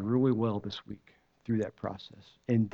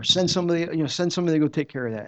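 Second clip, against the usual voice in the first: raised, urgent prayer, fast at the end; urgent retold plea; 5 semitones higher, 5 dB louder, faster than usual. Praying to God to send someone, urgent and louder. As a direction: push God to send somebody, faster and louder.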